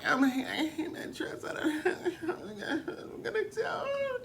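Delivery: high, laughing fake cry; mock whine. Jinx stressed about her dress, high mock cry. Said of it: "She's like".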